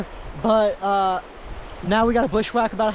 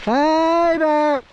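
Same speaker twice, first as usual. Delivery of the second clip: calling out to bears; 7 semitones higher, 4 dB louder than usual. Real, not acted. Hiking in bear country.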